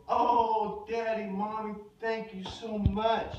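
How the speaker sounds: high, loud, slow grateful cry; overjoyed thanks. Retold or imitated. Billy thanking his parents for the pony, overjoyed. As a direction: gush your thanks like a thrilled kid. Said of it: "And he goes".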